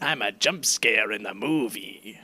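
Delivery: silly clown. Pretend. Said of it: "I'm gonna do live action".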